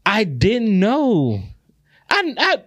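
high, slow protest of innocence; remorseful pleading. Real, not acted. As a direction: drag it out, pleading ignorance. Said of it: "I felt so fucking bad".

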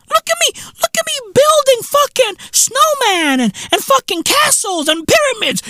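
high, excited show-off voice; mock bragger. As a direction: squeal it like a bragging tourist. Mocking bragging snow tourists.

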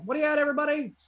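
video opening greeting. Retold or imitated. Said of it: "I used to start my video being like".

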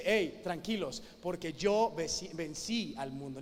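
calm reassurance. Acted. Jesus telling worried followers to relax, low and soothing. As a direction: settle them down gently.

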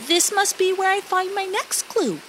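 high, small goop voice to himself; a little goop wondering aloud; Pie Jam thinking. Acted. Pie Jam muses at the waterfall, high goop voice. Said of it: "thought Pie Jam".

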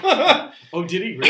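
high, loud laughing surprise. Real, not acted. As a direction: burst out laughing. Laughing surprise at tax story.

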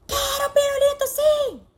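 put-on very high shrill child yelling for a lollipop; mock tantrum, high and loud; screaming child. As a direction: yell it like a bratty little kid. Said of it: "Ou ela grita".

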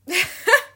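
high, loud crying laugh. Real, not acted. After bragging about her boobs, she laughs loudly. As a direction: cackle high and loud, near tears.

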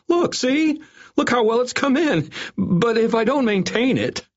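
desperate pleading. A narrator's reading. Desperate doctor shows his hair.

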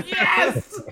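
high-pitched laughing cheer; triumphant yes. Retold or imitated. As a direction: cheer like the hero just won. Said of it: "And I was like".

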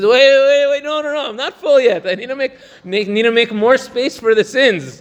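high, pleading mock voice of a sinner cramming in sins; mock eager sinner.

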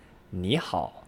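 你好 slowly, 你 rising, 好 dipping low; second tone then third. Shown as a demonstration. Teacher showing double third tone exception, 你好 rising then dipping. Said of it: "as if it were that second tone, and then the third tone".

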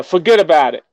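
put-on New York accent on forget about it. Host doing his go-to New York accent for foreign friends. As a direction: bust it out like a New York City local. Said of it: "mimicking the New York City accents".

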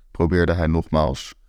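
usual speaking voice

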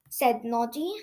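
ordinary delivery

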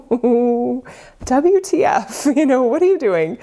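high, slow, laughing mock outrage; hammed-up baffled reaction through laughter; laughing disbelief. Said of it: "And I'm just like".

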